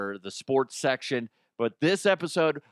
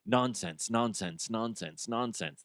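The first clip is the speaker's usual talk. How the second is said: droning nonsense; 3 semitones lower, 6 dB quieter than usual. Retold or imitated. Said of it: "is essentially saying".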